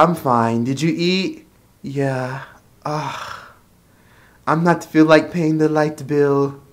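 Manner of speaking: slow, soft, drawn-out mom voice; mom on the phone. Retold or imitated. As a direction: imitate a mother mumbling slowly on a call. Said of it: "she'd be like".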